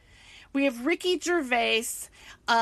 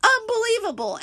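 disbelief; 10 semitones higher, 5 dB louder than usual. Real, not acted. Disbelief after a pause.

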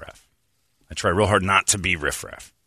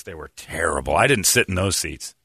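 normal talking voice

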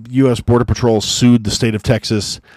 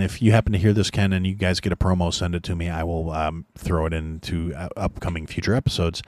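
softer, lower, easygoing request; gentle; 4 semitones lower, 5 dB quieter than usual. Real, not acted. Host gently asking Ken for a promo, gentle and soft. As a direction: ask it gently, voice dropped. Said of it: "a little more gently to Ken".

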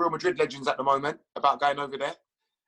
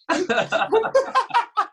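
high laughter; 5 dB louder than usual. Real, not acted. Laughs at his own story.

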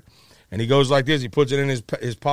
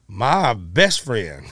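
drawn out, dreamy and very slow; slow, awed; much slower than usual. Retold or imitated. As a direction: savor each word in disbelief. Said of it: "And I'm thinking".

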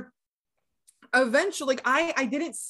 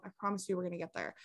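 quiet; 5 semitones lower, 10 dB quieter, much faster than usual. Real, not acted. Reassuring listeners before long story.

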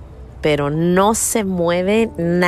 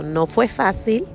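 normal talking voice

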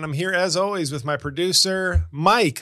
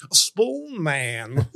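Cornell West; 2 semitones lower than usual. Shown as a demonstration. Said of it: "If I dress as Cornell West".

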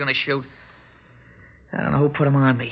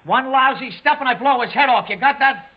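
shouted threat; 8 semitones higher than usual. Acted. Gunman shouting a threat out to the cop, angry. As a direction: bark the threat outward.

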